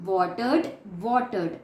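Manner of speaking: normal talking voice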